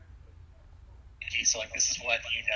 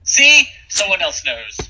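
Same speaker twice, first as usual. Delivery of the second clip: excited; 7 semitones higher, 13 dB louder, slower than usual. Real, not acted. Vindicated over a shared reference.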